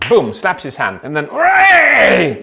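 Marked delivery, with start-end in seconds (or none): 1.2-2.4 high, slow cheer; loud hooray. Shown as a demonstration. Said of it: "Increases his voice and volume".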